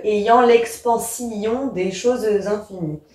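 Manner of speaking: "expansion" with -sion split into si-on; diaeresis. Shown as a demonstration. Teacher reading a Baudelaire line to show diaeresis, syllables split. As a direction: read the verse giving "expansion" an extra syllable, si-on. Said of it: "une syllabe supplémentaire qu'on n'a pas".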